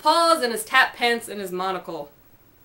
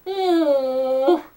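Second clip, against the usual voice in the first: muffled oh; 2 semitones higher than usual. A narrator's reading. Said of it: "sounded more like".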